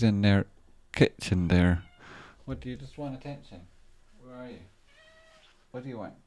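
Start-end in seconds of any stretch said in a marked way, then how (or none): 2.5-6.1 soft pet talk. Real, not acted. Talking softly to cat.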